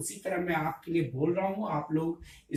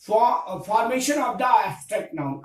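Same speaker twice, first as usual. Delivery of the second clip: very slow, word by word English definition; slow classroom dictation; 3 semitones higher, 4 dB louder, much slower than usual. Shown as a demonstration. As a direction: dictate it slowly so students can write.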